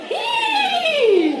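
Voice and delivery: high, loud whinny imitation; horse neigh. Shown as a demonstration. Teacher shows the children a horse, loud high neigh. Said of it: "dat doen we even allemaal naar een paard na".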